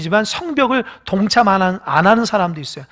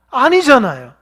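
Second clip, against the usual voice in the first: ordinary talk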